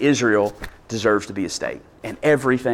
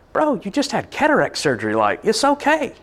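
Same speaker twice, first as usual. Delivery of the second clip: higher, mock-calming retort; incredulous aside; 7 semitones higher than usual. Listener telling the ranting patient to calm down, amused disbelief. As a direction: talk the ranting old man down, incredulous. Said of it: "And you're like".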